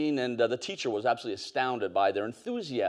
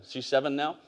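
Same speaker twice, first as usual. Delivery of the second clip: ordinary talk, nothing marked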